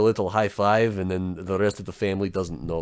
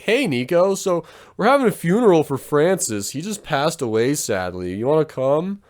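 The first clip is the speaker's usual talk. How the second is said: mock cheerful relative; 7 semitones higher, 5 dB louder than usual. Family calls Nico to funeral.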